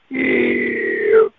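long creaky door noise, far below normal pitch; drawn-out low creak. Retold or imitated. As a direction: imitate a slow door creaking open. Imitates door creaking open slowly.

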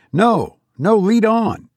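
high-pitched, hasty insistence; exclaimed. A narrator's reading. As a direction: urge the guide onward emphatically. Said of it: "I exclaimed".

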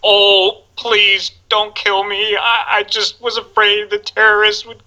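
high-pitched, mock-frightened pleading in an American accent; Alan Rickman. Villain posing as a scared hostage to the hero, high mock pleading. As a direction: plead like a fake hostage, voice pushed way up. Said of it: "I had to do this American accent".

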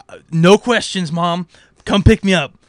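loud, pressing plea to mom; urgent demand. Retold or imitated. Teen on the landline urging his mom, loud and pushy. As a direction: insist she come now, loudly.

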